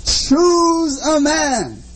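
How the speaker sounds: slow, drawn-out challenge; a hint of a booming giant. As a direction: stretch the command like a giant. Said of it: "in a booming voice".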